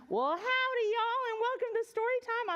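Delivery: put-on a big friendly cowgirl hello in a Southern accent. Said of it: "switch into my cowgirl accent".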